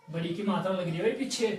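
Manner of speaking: ordinary delivery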